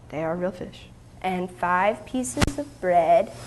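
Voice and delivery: ordinary delivery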